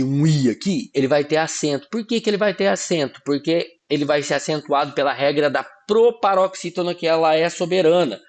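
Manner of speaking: normal talking voice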